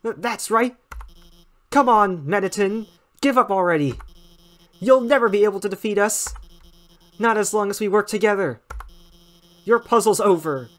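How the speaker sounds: fast, stammering bravado; timid scientist turned bold. Acted. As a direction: shout back with shaky courage. Alphys taunting Mettaton.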